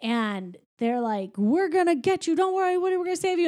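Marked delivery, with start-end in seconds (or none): 1.4-3.5 high, urgent rescue calls; mock heroic rescuers. Toys bursting in to save Woody, mock heroic. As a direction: play the gallant rescuer, pitched up.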